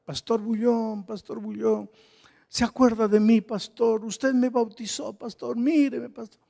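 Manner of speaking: high, pleading mock call from the crowd; woman calling out. Woman in the crowd calling to the pastor, high pleading call. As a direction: pitch it up and call out, pleading to be recognised. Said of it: "me gritaba".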